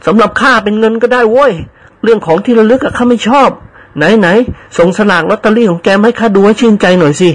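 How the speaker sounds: gruff teasing elder. Acted. Old master teases servant about lottery, gruff high tease. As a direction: demand to see the ticket, half joking.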